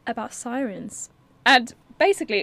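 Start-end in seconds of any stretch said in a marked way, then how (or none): 1.4-2.4 a burp escaping mid-word; talking through a burp. Real, not acted. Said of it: "I went to burp and talk and came out with that really weird noise".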